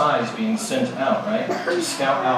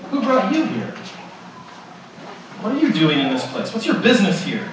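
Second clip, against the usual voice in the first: higher, prying questions to a stranger; curious questioning; 6 semitones higher than usual. Acted. Spies quizzing the stranger priest, curious and high. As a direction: press the stranger with nosy questions.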